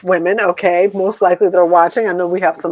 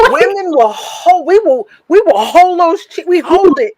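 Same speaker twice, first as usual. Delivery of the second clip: mock dramatic wail; 8 semitones higher than usual. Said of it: "let's keep it real".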